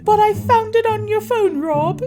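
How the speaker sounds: high, loud whiny accusation; imitating Sophie. Sophie confronting Rob about the phone, high whiny voice. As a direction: accuse him in a high put-on voice.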